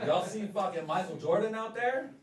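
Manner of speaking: loud, high mock outrage; outraged shout. Real, not acted. Comic ranting to crowd about Jordan, loud. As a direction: rant it full volume.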